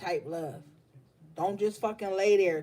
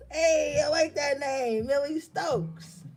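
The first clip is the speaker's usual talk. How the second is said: delighted; 7 semitones higher than usual. Real, not acted. Delighted by a viewer's name.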